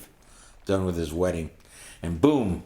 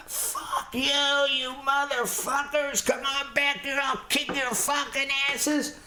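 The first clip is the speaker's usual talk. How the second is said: very high strained yell held back in volume; losing it. Retold or imitated. Enraged hitchhiker yelling after the car that threw a bottle, high furious mock scream. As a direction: scream curses after them at a far-off pitch. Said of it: "I fucking lose it".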